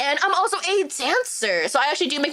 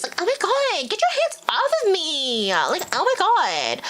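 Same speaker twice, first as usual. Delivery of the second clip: put-on valley girl; 6 semitones higher, slower than usual. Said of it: "my Valley Girl accent".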